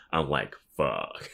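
ordinary talk

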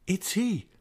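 high-pitched cry of recognition; startled, high exclamation. A narrator's reading. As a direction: exclaim it suddenly, pitch leaping up. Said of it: "he exclaimed".

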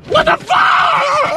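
very high, slow drawn-out scream; anguished scream. Acted. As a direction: scream it to the sky. Heartbroken outburst in the car.

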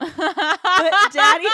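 laughing shriek. Real, not acted. Laughing breakdown.

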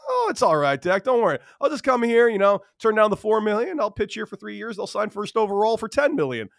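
high, breezy teammate imitation; Gerrit Cole. Young pitcher reassuring his senior teammate, high-pitched. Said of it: "interesting high-pitched voice".

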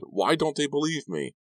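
high, anguished question; distressed plea. A narrator's reading. Kerry asking his friend why nobody believes him, distressed plea. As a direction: pitch leaps up, begging to be believed.

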